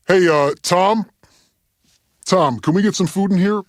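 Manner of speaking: calling out. Acted. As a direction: call across to the engineer. Calls to engineer off mic.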